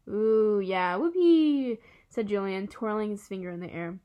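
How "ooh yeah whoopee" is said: loud, drawn-out mocking cheer; mock enthusiasm. Julian scoffs at painting backdrops, mock enthusiasm. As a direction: whoop it slowly with fake excitement.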